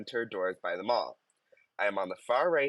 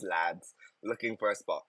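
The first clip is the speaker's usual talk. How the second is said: ordinary talk, nothing marked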